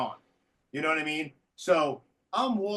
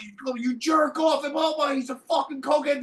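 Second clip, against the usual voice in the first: high, loud imitation of a mother yelling; mock screaming; 8 semitones higher, 6 dB louder than usual. Imitating Donna screaming at her kid in the show. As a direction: yell it like the furious mom in the act. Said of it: "she starts screaming and yelling".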